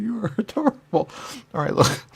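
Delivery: ordinary delivery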